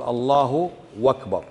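"الله أكبر" run together as "Allahu wakbar"; "الله أكبر" mispronounced with a linking w; wrong waw inserted. Shown as a demonstration. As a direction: slide a w into "أكبر" after a drawn-out "الله". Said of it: "حرف الواو".

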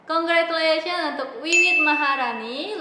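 high, loud winner call; hyped giveaway announcement. Acted. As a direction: cheer the winner's name.

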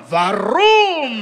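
drawn-out toy car engine vroom; toy car sound. Acted. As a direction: imitate a revving toy car. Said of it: "Can you do a sound effect for a little toy car".

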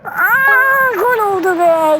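a high, loud shout of joy at the goal; real goal celebration yell. Real, not acted. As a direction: yell it out as the ball goes in. Goal scored in match.

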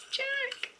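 high, thrilled gasping delight; delighted squeal. Real, not acted. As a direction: squeal over each new gift. Unboxing gifts, delighted.

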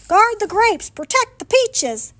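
high, loud, urgent howl; Daddy's howl. A narrator's reading. Daddy ordering the family to shield fruit from hail, high howl. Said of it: "Daddy howled".

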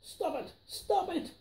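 high, pleading cry of stop; a frantic plea to make it end; exasperated protest. A narrator's reading. Knox begs Fox to stop, high exasperated cry. Knox fed up with tongue twisters.

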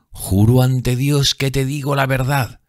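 high, slow earnest oath; fervent exclamation. A narrator's reading. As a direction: swear it with fervor. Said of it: "exclamó".

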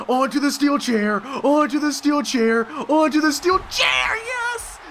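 high, stretched, repeated battle cry; excited yelling, building. Real, not acted. Player slamming the opponent onto a chair, building excitement. Player chants as move lands.

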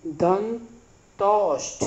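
दंतोष्ठ very slowly, broken as दं... तोष्ठ with a pause; slow, split word. Shown as a demonstration. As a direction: stretch दंतोष्ठ out in two separate parts. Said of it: "तो इसको कह सकते हैं". (दंतोष्ठ को तोड़कर, बहुत धीरे)

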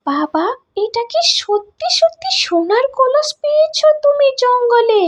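very high, put-on girl's voice; high-pitched astonished little girl; amazed daughter. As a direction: gasp in disbelief as an excited child.

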